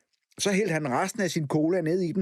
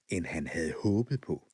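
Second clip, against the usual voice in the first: usual speaking voice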